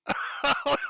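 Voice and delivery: high burst of laughter. Real, not acted. Host looking at the paused clip, laughing. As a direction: crack up helplessly.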